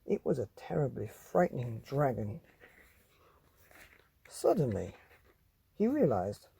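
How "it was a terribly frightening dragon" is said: ordinary delivery, nothing marked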